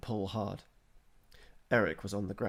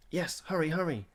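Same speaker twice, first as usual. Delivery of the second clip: eager urging; 8 semitones higher, 5 dB louder than usual. A narrator's reading. Boy urging his mount on.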